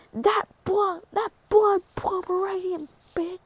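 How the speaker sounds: high put-on disdain; high-pitched catty character voice; acted jealous insult. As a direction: spit out mock jealousy in a high voice.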